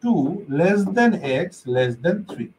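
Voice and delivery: each word spelled out slowly; slow demo reading. Shown as a demonstration. Teacher shows students how to read it, slowly. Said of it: "তাহলে এটার উচ্চারণ কি করতে হবে?".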